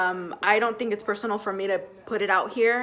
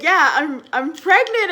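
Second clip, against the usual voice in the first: excited; 6 semitones higher, 7 dB louder, slower than usual. Retold or imitated. Telling husband, starts crying.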